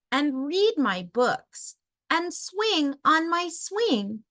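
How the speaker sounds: put-on high, childlike pleading voice; a small child begging to stay home; pleading little raccoon. A narrator's reading. Chester pleads with his mother.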